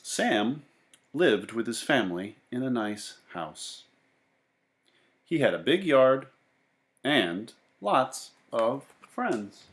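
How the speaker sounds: lively read-aloud voice for a class; storybook narration. A narrator's reading. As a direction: read it to the kids, with bounce and wonder. Teacher reads picture book aloud.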